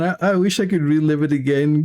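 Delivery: usual speaking voice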